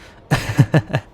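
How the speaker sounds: loud, short burst of laughter; amused laugh. Acted. Amused by the listener's doubt.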